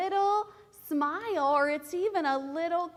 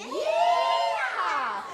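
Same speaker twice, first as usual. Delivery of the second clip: high, loud cowboy whoop; cowboy yeehaw; 6 semitones higher, 6 dB louder than usual. Shown as a demonstration. Teacher ending the cowboy chant with a loud yeehaw. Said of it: "like a cowboy".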